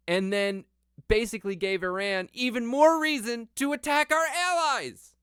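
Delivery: high, loud exasperated rant; outraged. Real, not acted. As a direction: pitch climbing with disbelief.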